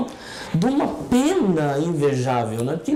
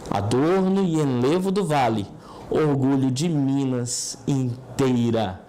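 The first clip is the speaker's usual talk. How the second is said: poem recitation; 4 semitones lower than usual. Acted. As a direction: declaim it like a civic ode. Recites the city anthem poem.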